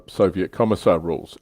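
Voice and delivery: usual speaking voice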